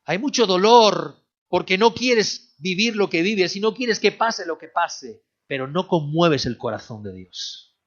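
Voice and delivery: loud, high, slowed cry from the pulpit; impassioned preaching. Acted. Preaching climax.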